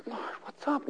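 quiet, puzzled prayer; hushed prayer. Retold or imitated. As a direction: whisper a question to the Lord. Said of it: "I'm like".

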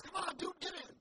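high, loud urging shout; calling out. Acted. Rescuer in a boat to the man in the flood, calling out.

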